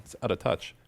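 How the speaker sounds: normal talking voice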